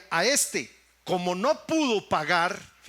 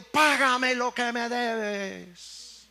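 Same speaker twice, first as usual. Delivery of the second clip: amenaza del siervo; 4 semitones higher, much slower than usual. Acted. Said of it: "lo ahogaba diciendo".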